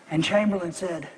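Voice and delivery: ordinary talk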